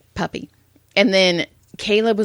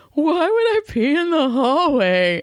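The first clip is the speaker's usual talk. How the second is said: higher, put-on drunk voice; amused drunk husband; 8 semitones higher than usual. Wife imitating drunk husband denying the hallway accident, high and amused. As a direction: mimic a drunk who finds himself hilarious. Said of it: "he thought it was the funniest thing".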